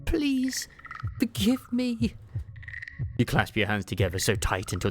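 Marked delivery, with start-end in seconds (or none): 0.0-2.1 high, slow tearful begging; high tearful plea after collapsing. Acted. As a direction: plead through tears, voice up high. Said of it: "ragged sobs".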